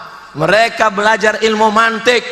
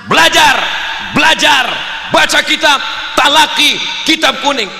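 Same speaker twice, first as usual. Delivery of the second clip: rousing exhortation; 6 semitones higher than usual. Acted. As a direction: fire up the students item by item. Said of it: "bangkitkan semangatnya". (seruan lantang membangkitkan semangat)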